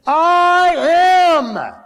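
a high, loud ringing I am; booming declaration. Acted. Jesus answering the arresting soldiers, booming and high. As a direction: proclaim it so the crowd falls back.

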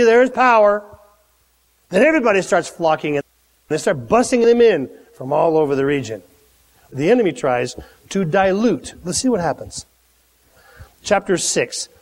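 ordinary talk, nothing marked

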